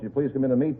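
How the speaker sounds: usual speaking voice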